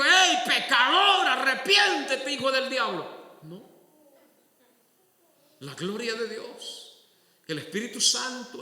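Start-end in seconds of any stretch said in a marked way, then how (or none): 0.0-3.1 higher and louder harsh preacher yell; mock condemning shout. Imagined preacher yelling at the sinner, loud. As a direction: blast the sinner from the pulpit. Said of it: "No vino nadie y le dijo".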